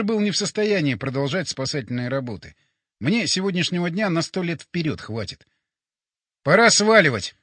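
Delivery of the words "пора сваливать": loud, clear announcement; said loudly. A narrator's reading. Hero makes sure батя hears, loud call. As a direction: raise it so he hears across the room. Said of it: "сказал я громко".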